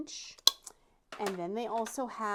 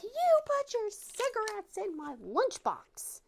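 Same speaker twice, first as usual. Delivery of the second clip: put-on high, slow accusing child voice, louder; 5 dB louder, slower than usual. Child confronts mom.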